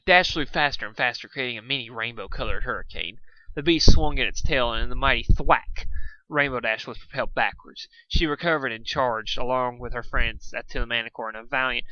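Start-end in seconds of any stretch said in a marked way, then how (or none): none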